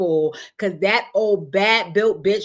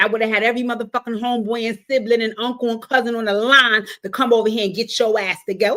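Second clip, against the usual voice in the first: ordinary talk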